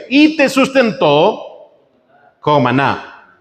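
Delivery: slow and lifted, leaning on each word; slow scripture emphasis. Acted. Preacher reading the verse slowly to his flock, with weight. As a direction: draw out the verse so it sinks in.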